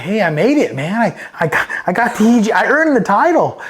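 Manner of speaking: high-pitched mock plea; protesting. New Marine protesting to the hecklers, mock pleading. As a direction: imitate your own indignant younger self, rising as you go.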